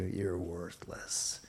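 hushed, slow, low insinuation; enemy's whisper. Acted. The enemy whispering to a believer, hushed and slow. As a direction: say it softly and slowly, like an accuser's whisper. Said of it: "the enemy will whisper to you".

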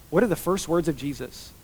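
normal talking voice

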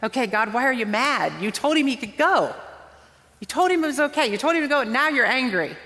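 complaining to God. Retold or imitated. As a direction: whine at God like a confused child. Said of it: "when we go".

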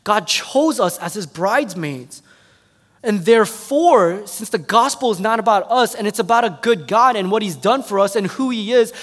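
impassioned sermon climax; full-force preaching. Real, not acted.

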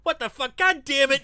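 high, loud outraged cursing; shocked angry yell. Retold or imitated. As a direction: blurt it out in disbelief. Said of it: "Freddy's like".

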